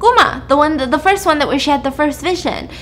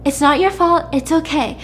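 soothing, pitched higher; reassuring; 7 semitones higher than usual. Retold or imitated. Brother comforts his crying sister, reassuring. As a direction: hush someone with gentle, high-pitched reassurance.

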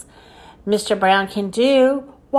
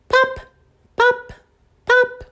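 high, short popping sounds; high-pitched separate cork pops; 13 semitones higher than usual. Acted. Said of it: "sound like a cork".

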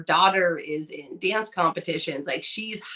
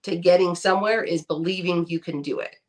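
ordinary delivery

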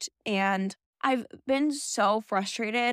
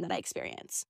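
breath-only trailing whisper; 14 semitones lower, 9 dB quieter than usual. Real, not acted. To viewers about gratitude, whispered. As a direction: let it fade to a whisper. Grateful reflection trailing to hush.

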